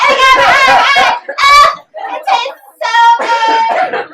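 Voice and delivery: loud, very high ecstatic cries; mock orgasmic moans. Fantasizing about a baguette, hammed-up orgasmic cries. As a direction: ham up the climax over bread.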